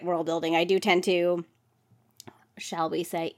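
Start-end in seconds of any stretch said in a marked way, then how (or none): 2.6-3.3 soft coy whisper; whispered aside. Real, not acted. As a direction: drop to a sly whisper. Coy aside before info dump.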